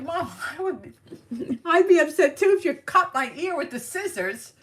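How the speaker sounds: very high-pitched mock outrage; retold incredulous protest. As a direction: protest in a high, half-laughing squeal. Retold indignant protest to mom.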